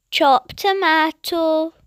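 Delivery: ordinary talk, nothing marked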